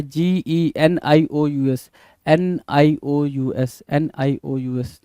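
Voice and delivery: normal talking voice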